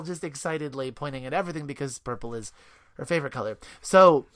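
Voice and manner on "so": ordinary delivery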